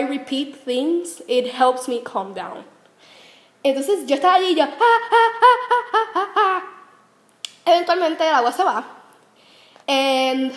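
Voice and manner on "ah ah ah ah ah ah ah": mock high-pitched panicked ah-ah-ah; high panicked wails. Retold panic in flooding shower.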